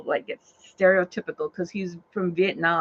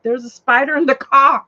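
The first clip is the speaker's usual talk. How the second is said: loud, raised cry of warning; retold alarm; 4 semitones higher, 8 dB louder than usual. Driver warning passenger about spider, retold alarm. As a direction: call it out in mock panic.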